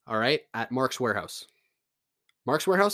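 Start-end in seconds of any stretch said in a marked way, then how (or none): 2.5-2.9 surprised self-correction. Real, not acted. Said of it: "did I say Mark's Warehouse".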